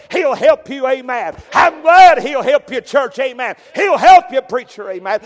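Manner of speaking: preaching shout. Acted. Preacher shouting praise at the church, full force.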